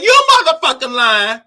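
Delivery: mock furious retort; indignant outburst. Child talks back to the landlord, laughing indignant. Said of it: "I was like".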